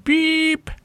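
very high steady piep; cat faking the tone of an answering machine; beep. A narrator's reading. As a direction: hit a squeaky electronic beep. Imitated beep.

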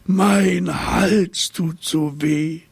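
high, very slow whining plaint; high, drawn-out giraffe lament. A narrator's reading. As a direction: moan it like a sore-throated giraffe. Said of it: "jammerte die Giraffe".

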